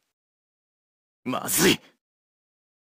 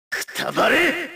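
raised, high-pitched attack yell; attack shout; 8 semitones higher than usual. Acted. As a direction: yell it at an enemy, pitch climbing. Attack line in a game. (敵に叫ぶように高く)

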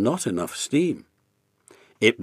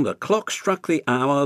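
plain reading voice

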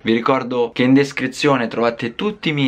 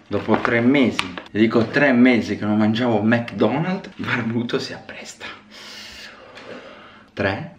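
usual speaking voice

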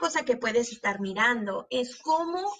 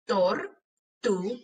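"Tortuga" slowly as tor, tu, ga; broken into syllables; much slower than usual. Shown as a demonstration. Teacher helping hear each sound, syllable by syllable. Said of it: "quiero que las quiebres entre sílabas".

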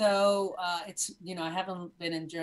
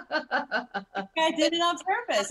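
high, slow, laughing through it; 9 semitones higher, 5 dB louder, much slower than usual. Real, not acted. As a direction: laugh the words out. Laughing joke after crowd cheers.

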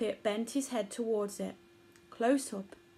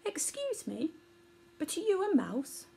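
higher, soft and curious little elephant; young Poppet voice; 6 semitones higher, 3 dB quieter than usual. Acted. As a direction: ask it like a polite, wide-eyed baby elephant. Baby elephant Poppet speaking.

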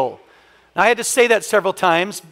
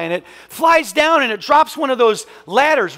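normal talking voice

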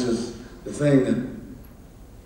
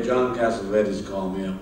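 ordinary delivery

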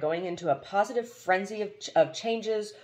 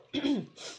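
throat clearing; 10 semitones higher than usual. Acted. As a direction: clear the throat to get attention. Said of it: "Haroun cleared his throat".

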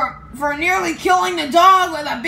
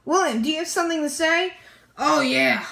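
usual speaking voice

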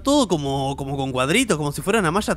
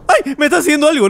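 startled alarm; 9 semitones higher, 5 dB louder, faster than usual. Real, not acted. Startled in the game.

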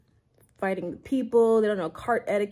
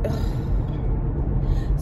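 voiceless exasperated "ugh"; disgusted ugh. Retold or imitated. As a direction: breath-only groan of distaste. Reaction to watching the clip.

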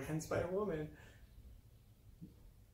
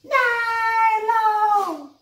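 loud; 15 dB louder than usual. Real, not acted. Shouting a guess with headphones.

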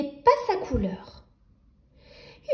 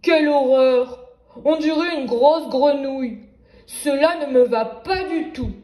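loud, disgusted wolf voice; a big wolf recoiling at his reflection, loud; wolf exclaiming; 5 dB louder than usual. Acted. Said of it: "et s'exclama".

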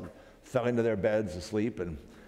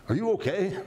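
higher, concerned wife asking; worried wife; 9 semitones higher, 4 dB louder than usual. Retold or imitated. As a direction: ask your husband if he's alright. Wife asks husband.

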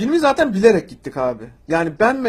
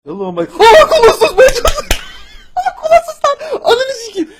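shrieking with laughing joy, much higher and louder; thrilled fan shout; 9 dB louder than usual. Shown as a demonstration. Moviegoer who didn't know the twist, shouting with delighted laughter. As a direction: scream with delight at a surprise hero. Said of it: "Anladın mı aradaki farkı".

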